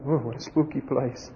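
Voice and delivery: hushed mock-nervous aside; soft, jokey spooked voice; mock scared. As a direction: imitate someone creeping through a cemetery, very softly. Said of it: "he's walking through the cemetery and he's going".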